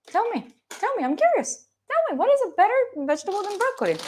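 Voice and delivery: playful high-pitched challenge to chat; insistent mock demand. Repeats demand, pushing chat.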